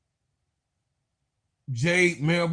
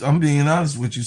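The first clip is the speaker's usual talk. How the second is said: ordinary talk, nothing marked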